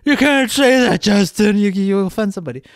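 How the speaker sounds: mock the easily offended kids, nagging; mocking whiny kids. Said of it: "most kids".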